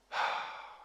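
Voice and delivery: heavy exhale after a rant; long sigh. Real, not acted. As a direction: let the breath out in one sigh.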